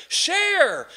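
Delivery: urgent share. Real, not acted. Pastor urging congregation to share.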